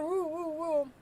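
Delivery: ordinary delivery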